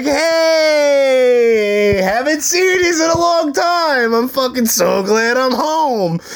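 high, drawn-out mock-delighted shout; overacted joyful greeting. Son faking a homecoming to his mother, hammed-up joy. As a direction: put on a big show of being thrilled. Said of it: "it was a fucking spectacle. Academy Award winners".

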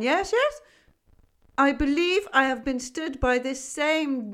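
loud, high, rambling goat voice; half-deaf goat scheming a plan; gruff old goat. A narrator's reading. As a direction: muse aloud with eager "yes, yes?". Goat voice held in long monologue.

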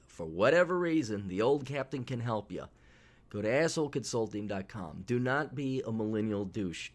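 ordinary talk, nothing marked

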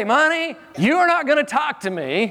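high, emphatic mock scolding; firm telling-off. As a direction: put money firmly in its place. Acted telling-off of money.

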